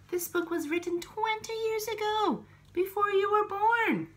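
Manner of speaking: squeaky high voice for the dog; high pet talk. Real, not acted. Telling the pet about the book.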